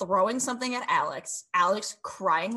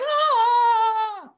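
high, loud mock wail; overdone fake sob; imitated crying wail; 9 dB louder than usual. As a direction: ham up a crybaby wail. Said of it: "She's just like".

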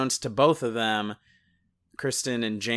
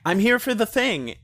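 mock vague entrance; 9 semitones higher, 3 dB louder than usual. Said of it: "it's like".

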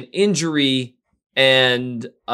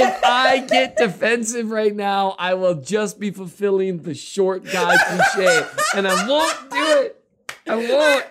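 higher, slower, through stifled giggles; fighting back laughter; 8 semitones higher than usual. Real, not acted. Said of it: "trying not to giggle".

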